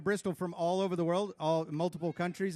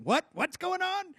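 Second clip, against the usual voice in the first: surprised operator; 11 semitones higher, 3 dB louder, slower than usual. Retold or imitated. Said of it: "imagine the operator listening".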